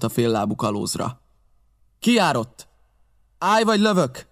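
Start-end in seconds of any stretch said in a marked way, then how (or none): 2.0-4.3 high, sharp challenge shouted across the yard; a captain shouting a challenge at the palisade; sentry challenge. A narrator's reading. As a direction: call out a warning to the strangers. Captain challenges approaching pirates.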